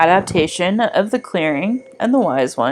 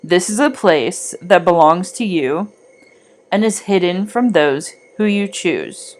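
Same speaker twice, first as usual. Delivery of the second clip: guided meditation. Acted. Guiding listeners back from the Wise One's clearing, calm meditation voice. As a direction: guide the listener calmly back along the forest path.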